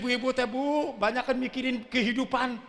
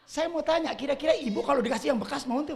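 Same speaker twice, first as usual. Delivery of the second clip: ordinary talk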